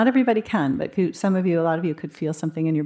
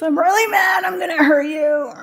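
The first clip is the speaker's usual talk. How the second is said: much higher, louder hammed-up angry shout; mock furious threat; 11 semitones higher, 6 dB louder than usual. Shown as a demonstration. Teacher acting out anger that takes over, hammed-up furious shout. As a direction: yell it as if the rage has swallowed you whole. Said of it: "That's the difference".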